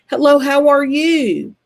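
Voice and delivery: slow, loud, high country greeting; drawn-out country hello; country accent. Retold or imitated. Mimicking Tennessee relatives, drawn-out country accent. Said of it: "Very country".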